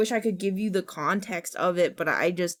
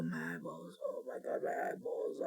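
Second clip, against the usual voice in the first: whimpered groan; 4 semitones lower, 15 dB quieter than usual. Real, not acted. Groaning after gross image.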